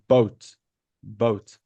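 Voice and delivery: careful teacher's "Boat", falling in pitch; pronunciation model. Shown as a demonstration. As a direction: isolate each "Boat" with a clear round O. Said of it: "Listen to my pronunciation".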